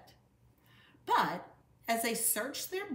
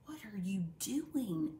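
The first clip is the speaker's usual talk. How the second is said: whisper. Acted. Sis asks quietly at dinner.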